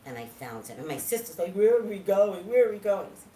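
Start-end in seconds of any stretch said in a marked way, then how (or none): 1.5-3.1 loud, higher-pitched imitation of a pestering sister; mock pestering. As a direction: raise the voice, repeating it like an impatient sister. Mimics sister's insistent repeated question.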